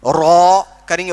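रॉ said with rounded lips, too much like waw; wrong rounded raa. Shown as a demonstration. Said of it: "वो भी गलत हो गया".

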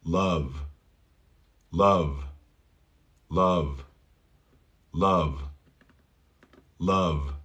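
low, falling "Love" with relaxed uh; repeated model "Love", short U. Shown as a demonstration. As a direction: say "Love" with uh, then voiced V. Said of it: "el sonido corto de la U".

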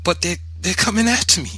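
high, loud, stumbling plea; frightened protest. A narrator's reading. Boy at the steps fearing the gang, high frightened protest. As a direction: protest in panic, tripping on the words.

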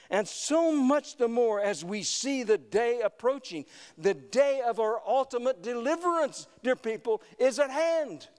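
high, urgent preacher's delivery; impassioned preaching. Real, not acted. Preacher urging the congregation to come together, impassioned and high.

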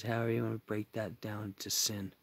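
hushed aside, barely voiced; whisper. Real, not acted. Whispering an aside to viewers about the church. Aside after drawn-out word.

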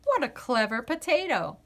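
usual speaking voice

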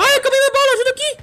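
very high, panicked squeal; panicked cry for help. Real, not acted. Player out of ammo calling squad for help, panicked. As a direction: squeal for backup, out of bullets.

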